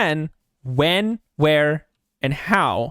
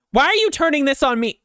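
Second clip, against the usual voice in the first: imitated, higher-pitched indignant complaint; mock her wounded protest, pitched up; upset accusation; 9 semitones higher, 4 dB louder, faster than usual.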